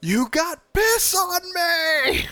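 very high, slow mock squeal; hammed-up shriek of disgust; mock outraged squeal. Imitating a movie outburst.

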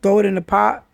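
normal talking voice

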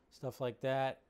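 ordinary talk

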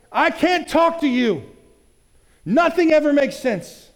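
high, slow, strained complaint; exasperated outburst. Retold or imitated. To a partner, an exasperated give-up cry. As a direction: throw up your hands, it's hopeless. Acted outlandish thought, exasperated.

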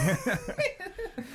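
high cackling witch laugh. Acted. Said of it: "a, a witch laugh".

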